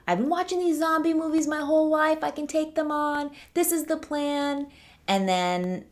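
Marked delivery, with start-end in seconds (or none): 0.1-4.7 much higher, quick mock-bragging voice; cocky zombie expert. Mocking a know-it-all fan bragging he'll survive zombies, high and cocky. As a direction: imitate an overconfident fan who thinks he can survive.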